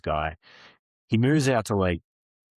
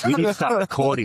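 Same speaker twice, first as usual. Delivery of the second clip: higher and louder, eager push; excited decision; 8 semitones higher, 4 dB louder than usual. Retold or imitated. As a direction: sell the big idea with a rush.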